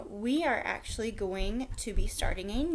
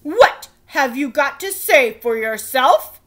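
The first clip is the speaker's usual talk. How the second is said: demanding king; 11 dB louder than usual. A narrator's reading. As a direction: boom it down at the boy. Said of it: "the king demanded".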